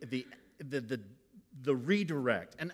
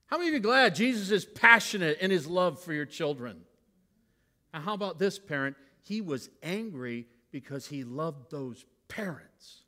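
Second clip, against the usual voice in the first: normal talking voice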